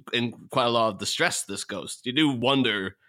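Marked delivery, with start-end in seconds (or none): none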